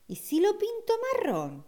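high wondering question. Acted. Child wondering aloud.

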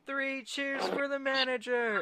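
very high drawn-out mock cheer; acted cheer. Crowd hailing the manager, high mock cheer. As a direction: stretch it out like a cartoon crowd. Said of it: "they're all like".

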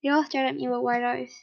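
ordinary delivery

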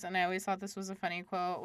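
ordinary delivery

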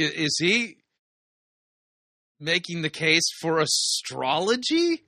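slow, drawn-out disbelief with rising end; incredulous question. Real, not acted. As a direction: stretch it into a puzzled question. Incredulous reaction to played clip.

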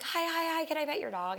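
high, eager greeting to a puppy; girl rushing up to pet a puppy, high and eager; excited squeal. Retold or imitated. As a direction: gush over the puppy in a high voice. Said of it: "I was like".

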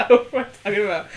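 high loud laughter into words; laughing hard. Real, not acted. Laughing at her own slip to her co-host. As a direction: crack up and ask through it.

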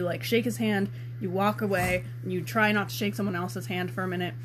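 normal talking voice

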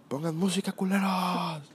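put-on slow, high, slurred drunken demand; mock drunk slur, stretched and high; drunk friend's voice. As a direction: imitate the drunk buddy calling out, dragging it. Drunk friend demanding music again.

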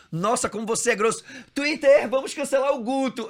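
high, loud outraged whining; mock offended voice. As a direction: ham up the indignation. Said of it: "resposta imaginada no Twitter". (indignação fingida, aguda e alta)

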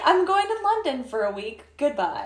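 tossing off a breezy goodbye; mock Maxim. Mocking husband's casual exit.

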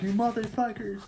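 high, held-back mock yell; mock threat. Chuck pointing the sheriff's gun, mock angry threat. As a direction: pitch the curse up high without full volume.

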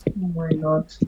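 soft whispered aside. Real, not acted. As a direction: breathe it under your breath. Aside while stepping away.

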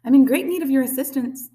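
high, loud polite appeal; big friendly robot voice; friendly booming. A narrator's reading. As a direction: call it out cheerily over the water. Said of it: "the robot's friendly voice boomed".

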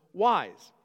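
"wise" set apart and said loud; emphatic "wise". Shown as a demonstration. Preacher drilling the key word, loud emphasis on "wise". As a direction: punch "wise" alone after a pause. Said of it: "Emphasis on the word".

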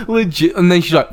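ordinary talk

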